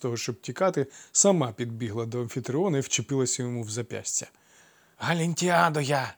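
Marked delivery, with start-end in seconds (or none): 5.0-6.1 slightly higher spluttering crone voice; grimacing old dwarf. A narrator's reading. Old dwarf woman introduces herself to Amphitryon. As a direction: sputter and pull faces. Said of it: "плюючись і смішно кривляючись".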